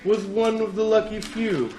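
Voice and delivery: big booming movie trailer read; TV announcer voice. Acted. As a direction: sell it like a TV ad announcer. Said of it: "I think I'm just doing the T V announcer voice".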